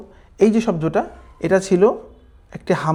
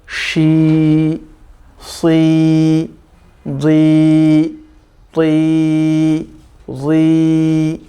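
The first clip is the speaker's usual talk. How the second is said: each syllable spaced, slow and a bit louder; slow syllable drill; 3 dB louder, much slower than usual. Shown as a demonstration. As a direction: call out the syllables for learners to repeat. Drills letter syllables slowly.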